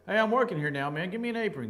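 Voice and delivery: normal talking voice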